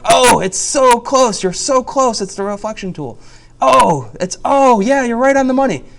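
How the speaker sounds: loud, high mock cheering-on; over-the-top teacher praise at full volume; exaggerated encouragement. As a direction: gush at a student, hammed up. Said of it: "I remember telling a student once".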